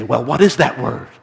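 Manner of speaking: loud, forceful question; insistent demand. A narrator's reading. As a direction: demand the secret loudly. Drivers demand the word.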